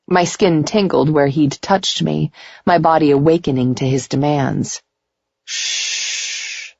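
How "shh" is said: soft unvoiced shush; whispered shush. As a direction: hush him gently. Hushing lover in bed.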